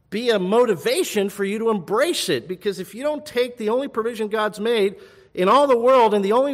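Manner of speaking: high, loud urgent preacher's voice; impassioned preaching. Real, not acted. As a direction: preach it out loud, pleading and warning. Preaches urgently at full force.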